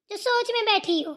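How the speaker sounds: normal talking voice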